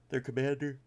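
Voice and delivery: words stretched through a yawn. Real, not acted. Tired reviewer yawns while listing cards, yawning through it. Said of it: "whew, sorry for yawning".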